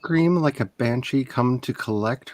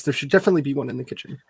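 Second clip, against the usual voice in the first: rushed; much faster than usual. Acted. Repeated, rushed.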